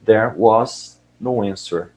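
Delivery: There was no answer, said very slowly, each word spaced out; slowed for learners. Shown as a demonstration. Teacher repeating the audio line for students, very slow. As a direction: spell it out slowly for the class. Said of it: "Então".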